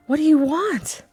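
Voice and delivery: high-pitched frightened question; fearful demand. Acted.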